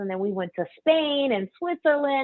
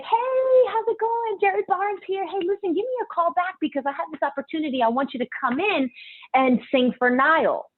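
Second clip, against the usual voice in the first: imitating Jerry; 8 semitones higher than usual. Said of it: "Jerry's like".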